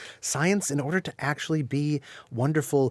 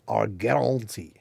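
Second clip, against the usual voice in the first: lightly put-on French accent, drawn out; slow, mock-French pronunciation; bad French accent; slower than usual. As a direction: slip into a hammy French accent. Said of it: "bad French accent".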